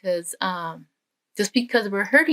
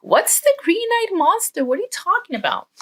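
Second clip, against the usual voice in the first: put-on much higher, loud bewildered kid's question; puzzled child; 11 semitones higher, 4 dB louder than usual. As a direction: ask like a boy who has never heard the phrase. Child confused by dad's phrase.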